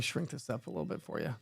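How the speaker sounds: quick unvoiced whisper; hushed quick aside. Real, not acted. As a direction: mutter it under your breath as an aside. Aside while resizing image.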